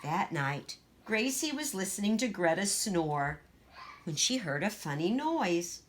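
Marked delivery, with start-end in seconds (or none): none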